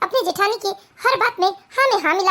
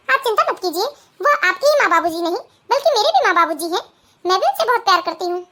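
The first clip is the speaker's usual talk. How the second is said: usual speaking voice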